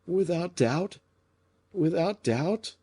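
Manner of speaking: high, slow distracted murmur; absent-minded. A narrator's reading. Fouquet answering d'Artagnan while lost in thought, distracted. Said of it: "still absent".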